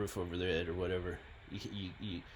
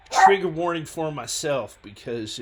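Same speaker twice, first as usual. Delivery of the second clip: ordinary talk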